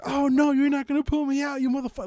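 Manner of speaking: put-on loud, high Jersey accent rant; Joe Pesci. Talking dookie refusing to be pulled out, Jersey accent. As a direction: protest loudly in a tough Jersey accent. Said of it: "a Jersey accent, Joe Pesci sounding".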